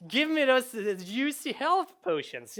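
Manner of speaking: high, loud mock demand; hammed-up gamer after the loot; greedy player. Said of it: "but you're like".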